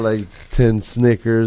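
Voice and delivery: ordinary delivery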